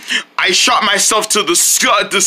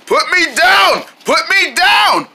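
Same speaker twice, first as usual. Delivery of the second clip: high, insistent cartoon yelling; hammed-up panic; panicked demand; 9 semitones higher than usual. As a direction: yell to be let go. Owl being carried off.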